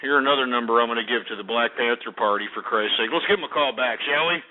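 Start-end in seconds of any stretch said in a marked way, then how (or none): none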